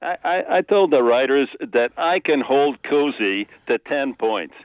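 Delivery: put-on light New York accent as Al; a touch of New York in Al's voice. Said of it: "use a little of the New York accent".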